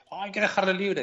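lightly voiced supporter; mock Catalan reply. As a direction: play the earnest fellow Catalan. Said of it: "le pregunto".

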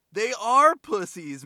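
ARE hit high, loud and drawn out; emphatic insistence. Retold or imitated. Retelling a dude backing up his friends, emphatic ARE. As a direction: imitate a dude insisting, leaning hard on "are".

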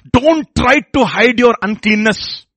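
high, loud, slow preaching cry; forceful warning. Acted. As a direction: thunder it out word by word.

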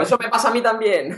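high, laughing call-out; mock shout at radio. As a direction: yell agreement at the radio, in jest. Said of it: "pues os voy gritando".

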